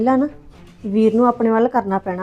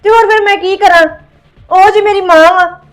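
higher, louder lament; 5 semitones higher, 5 dB louder than usual. Acted. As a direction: mourn the mother, playing it to the room. Staged suicide, lamenting her mother.

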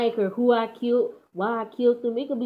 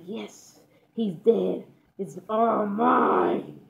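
gloating villain; much slower than usual. Retold or imitated. Dreamer gloats over his dead brother, slow and hushed. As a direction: savor each word like a greedy villain.

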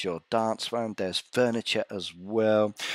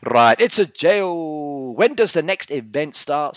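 reading a viewer; 5 semitones higher, 7 dB louder than usual. Retold or imitated. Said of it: "says Camille".

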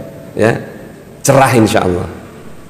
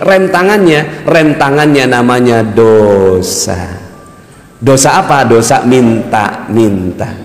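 slow emphasis; 4 semitones lower, much slower than usual. Real, not acted. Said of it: "preacher slows down to stress a point".

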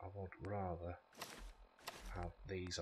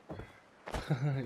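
laugh; 6 semitones higher, 9 dB louder than usual. Real, not acted. Laughing at own looting.

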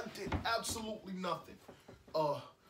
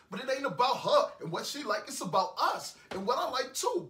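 loud, high indignant protest; 6 semitones higher, 9 dB louder than usual. Acted. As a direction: push back hard, voice raised. Heated complaint about the breakup.

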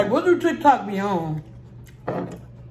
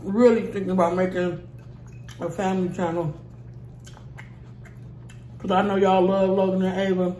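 eating salad between words; talking while chewing; 3 semitones lower, 5 dB quieter, faster than usual. Real, not acted. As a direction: mouth partly full. Seen 4: ate forkful at -2.0; mouth full, chewing while speaking.

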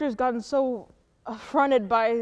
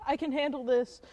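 ordinary talk, nothing marked

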